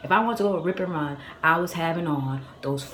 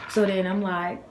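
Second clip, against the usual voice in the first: ordinary talk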